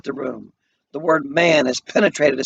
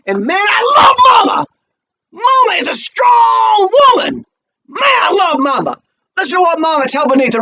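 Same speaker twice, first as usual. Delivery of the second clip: much higher, tearful shout; moved shout; 7 dB louder than usual. Real, not acted. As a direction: cry out overcome with feeling.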